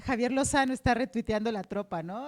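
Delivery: ordinary talk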